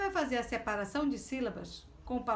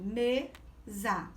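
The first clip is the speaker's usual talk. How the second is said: "Mesa" broken in two, me, za; split into syllables. Shown as a demonstration. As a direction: pause between me and za in "Mesa". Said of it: "São duas sílabas".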